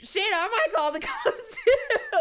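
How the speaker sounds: high laughing mock line. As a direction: laugh through the punchline. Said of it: "I'd be like".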